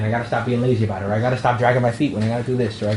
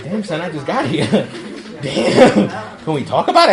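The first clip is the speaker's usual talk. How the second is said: stunned newcomer, half laughing; playfully acted bewilderment; laughing mock disbelief; 3 semitones higher, 3 dB louder than usual. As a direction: protest through chuckles. Acts stunned newcomer while laughing.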